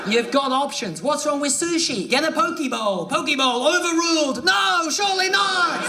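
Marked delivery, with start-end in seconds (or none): 3.0-5.9 mock judge shout. Mock courtroom ruling.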